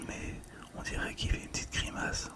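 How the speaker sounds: ordinary talk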